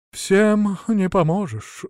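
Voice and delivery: slow, sighing resignation; mild resigned sigh. A narrator's reading. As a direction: let it out on a sigh. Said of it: "вздохнул Василёк".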